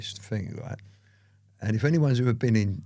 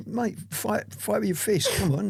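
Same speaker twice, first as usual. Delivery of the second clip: higher, pleading mate come on; indignant plea; 10 semitones higher than usual. Retold or imitated. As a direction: beg him to fight fair, exasperated. Retold plea to fight fair.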